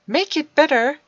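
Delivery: loud, high, scornful; loud high scornful retort; spat. Acted. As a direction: spit the words back at him. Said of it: "the boy spat".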